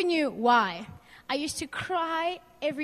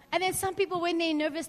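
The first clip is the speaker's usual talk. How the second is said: ordinary talk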